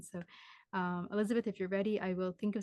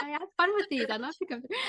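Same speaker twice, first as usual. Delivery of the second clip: lightly voiced cheerful mother; 6 semitones higher, 3 dB louder than usual. Medium voices the spirit mother.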